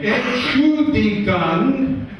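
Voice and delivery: mock German visitor, drawn-out and a bit sing-song; German tourist. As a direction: ask it slowly like a polite German visitor. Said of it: "coming up to you and saying".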